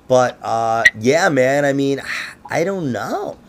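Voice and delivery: usual speaking voice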